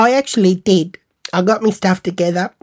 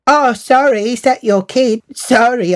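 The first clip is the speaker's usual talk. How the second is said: higher, put-on apologetic voice; exaggerated fake sorry; mock apology; 6 semitones higher than usual. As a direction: feign innocence with a raised pitch. Mock apology to offended mother.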